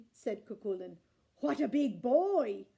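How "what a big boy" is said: slow, loud drawn-out giant; slow loud awed giant; impressed giant. A narrator's reading. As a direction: stretch it out, impressed.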